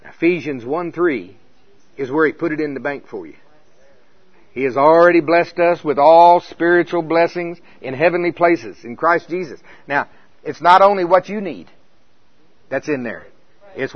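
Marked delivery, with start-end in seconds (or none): none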